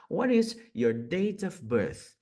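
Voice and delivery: slow, careful "What is your date of birth?"; slow model question. Shown as a demonstration. Teacher slowly models a question for learners. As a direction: ask it slowly so students can repeat. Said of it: "Olha só que interessante".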